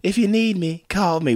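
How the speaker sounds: high, sultry diva voice; [name]. Retold or imitated. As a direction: purr it like a pop diva. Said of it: "something that [name] would say".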